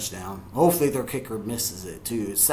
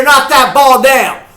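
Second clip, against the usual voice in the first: high, loud yell at a steady drive; excited shout; 12 semitones higher, 16 dB louder, slower than usual. Real, not acted. Fan cheering the defense's knockdown, excited shout. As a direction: yell it out to the players.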